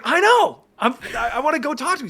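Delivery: high, loud, eager outburst; starstruck excitement. Retold or imitated. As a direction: retell it as a giddy, nervous fan. Retold nervous, starstruck excitement.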